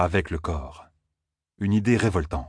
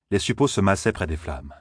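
plain reading voice

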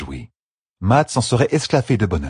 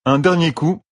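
announcing; 9 semitones higher than usual. Acted. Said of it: "annonça Matt".

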